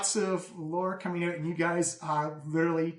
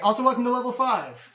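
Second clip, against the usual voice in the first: excited announcement; 6 semitones higher, 7 dB louder than usual. Real, not acted. Announcing the level up.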